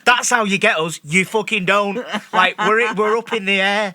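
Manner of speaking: loud, scolding rant at the crew; retold telling off. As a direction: tell the stewardesses off, loud and fed up. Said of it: "I literally turned around and went".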